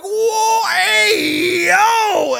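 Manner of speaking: high, drawn-out excited shouting; retold screaming. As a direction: scream it out as you relive the shock. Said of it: "I'm like, scra".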